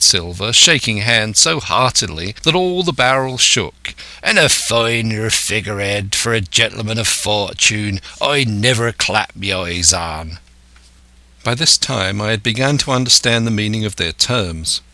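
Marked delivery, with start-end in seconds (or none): 4.2-10.4 put-on old pirate, approving. Silver praising his new recruit, approving old pirate. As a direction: stretch the vowels like an old sea-dog.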